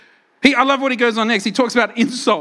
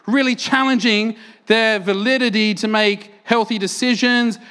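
ordinary talk

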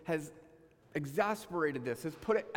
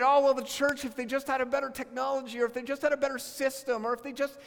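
higher, fast, exasperated rant; mock complaining in a higher, quicker voice; frustrated griping; 5 semitones higher than usual. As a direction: vent like a fed-up churchgoer. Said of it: "But I get so frustrated".

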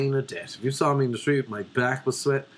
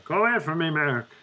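higher, put-on woman's voice; bad woman impression; 5 semitones higher than usual. Shown as a demonstration. Woman telling Mark to go away, put-on female voice. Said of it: "This is my accent of a woman".

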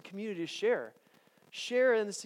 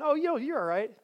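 dismissive reassurance; 8 semitones higher, 5 dB louder than usual. Retold or imitated. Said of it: "His answer isn't".

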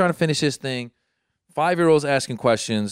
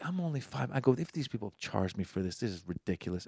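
grumbling to oneself; 2 semitones lower, 13 dB quieter than usual. Retold or imitated. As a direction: mutter the complaint under your breath. Grumbling privately about the late charge.

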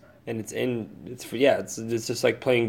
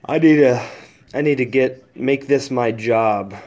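ordinary delivery

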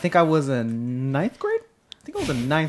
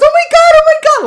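high, loud shrieked repeats; retold scream; 11 dB louder, faster than usual. Storyteller acting out the screaming neighbor for co-host, panicked shriek. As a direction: imitate the girl's frantic screaming. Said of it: "like that, right?".

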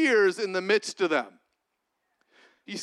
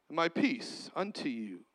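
solemn hush; 7 semitones lower, 8 dB quieter than usual. Acted. Quiet solemn repeat.

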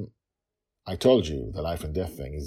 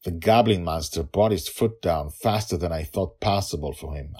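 ordinary delivery

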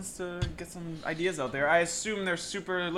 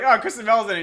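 mock excitement; 5 semitones higher, 10 dB louder, faster than usual. Imitating his own excitement.

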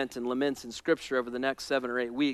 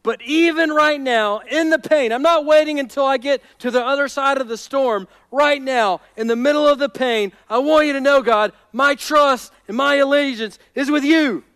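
raised, driving preaching voice; fervent declaration; 10 semitones higher, 9 dB louder than usual. Acted. David pledging trust to God amid the storm, fervent. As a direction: build steadily into a bold vow.

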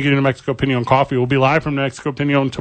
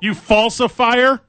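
mock accusation; 9 semitones higher, slower than usual. Mock accusing co-host of lying.

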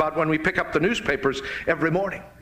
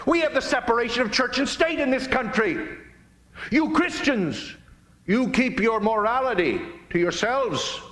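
higher, indignant mock protest; mock outcry; 9 semitones higher than usual. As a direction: parody the media crying foul, high and scornful. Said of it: "the immediate hue and cry from the media".

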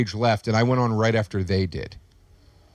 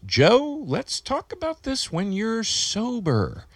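imitating Mike; 10 semitones higher, 4 dB quieter, much slower than usual. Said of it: "Mike Kaplan just goes".

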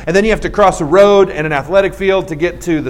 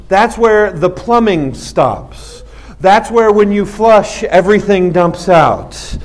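drawn out word by word; slow, deliberate explanation; much slower than usual. Real, not acted. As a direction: spell it out slowly for comic effect. Spells out gross meaning for effect.

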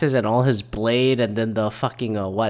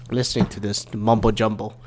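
ordinary talk, nothing marked

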